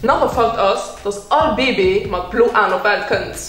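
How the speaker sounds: usual speaking voice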